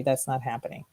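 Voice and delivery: usual speaking voice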